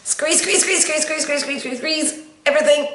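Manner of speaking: high and strained; straining squeeze. Shown as a demonstration. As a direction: push it out with effort. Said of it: "Try it again".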